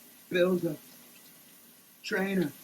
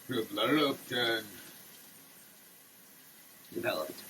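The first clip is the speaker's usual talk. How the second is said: much lower, mock-serious label read; deep announcer voice; 14 semitones lower than usual. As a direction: drop the voice deep like a commercial announcer. Reads address in put-on voice.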